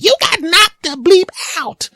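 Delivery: very high, slow squealed taunt; Chris Tucker. Retold or imitated. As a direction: squeal it down at the fallen man. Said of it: "Chris Tucker leans over and goes".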